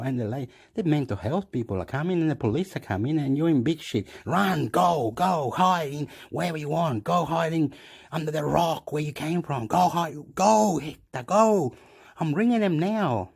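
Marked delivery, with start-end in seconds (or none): 4.3-13.3 higher, mounting urgency; urgent ordering. Retold or imitated. Acted urgent order to flee.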